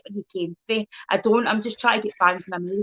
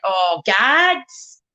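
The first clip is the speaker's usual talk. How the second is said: loud drawn-out Oh, gads!; exclaiming gads; 5 dB louder than usual. Retold or imitated. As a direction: blurt out "Oh, gads!" loudly. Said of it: "I went".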